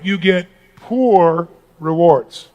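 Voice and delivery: you get poor rewards, dragged out slowly; slow, weighty reveal of the twist; slow dramatic punch. Acted. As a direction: land it slowly, word by word, like a reveal. Punchline of the good-job reveal.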